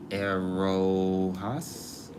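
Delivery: Erojas drawn out slowly with a rise; unsure slow Erojas; careful name try. Shown as a demonstration. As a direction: sound out Erojas syllable by syllable. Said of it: "I believe".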